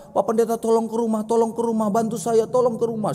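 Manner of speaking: a small kid pleading, mock tearful; pleading crying child. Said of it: "sambil nangis begitu anak kecil ini".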